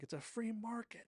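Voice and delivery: high-pitched indignant retort; playful squeal of protest; mock protest. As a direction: imitate someone arguing back, voice jumping up high. Acted retort in a haggling quarrel.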